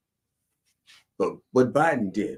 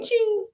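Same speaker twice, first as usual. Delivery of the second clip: cash register ching. Acted. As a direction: sing out a bright cash-register ring. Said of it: "my heart hears that little ching".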